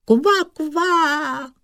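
very high, joyful frog squawk; cry of joy. A narrator's reading. Frog princess sees she was brought the sky, joyful cry. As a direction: squeal two croaks with delight. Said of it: "a vykřikla radostí".

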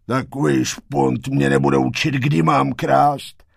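loud, level thief's voice; gruff thief. A narrator's reading. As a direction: brush off the kid as a loud crook. Zloděj odbývá Macha. (hlasitý zloděj, odsekává klukovi)

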